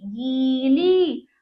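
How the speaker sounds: गीली very slow, both ई held long; drawn-out vowels. Shown as a demonstration. Teacher reads the word to children, drawn out. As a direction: stretch each syllable of गीली. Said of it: "हम इसको खींचेंगे".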